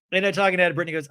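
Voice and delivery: normal talking voice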